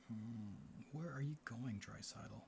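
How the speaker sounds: ordinary talk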